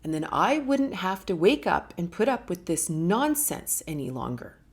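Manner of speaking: usual speaking voice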